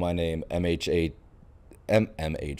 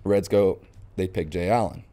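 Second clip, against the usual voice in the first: usual speaking voice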